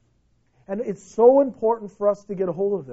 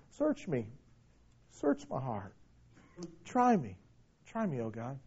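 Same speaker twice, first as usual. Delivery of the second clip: hushed prayer; 8 semitones lower, 12 dB quieter than usual. Acted. Quiet prayerful repetition.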